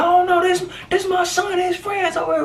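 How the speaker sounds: very high mock shout; imitating shrieking mother. Mother telling police these are her son's friends. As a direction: shriek it like a worried mom.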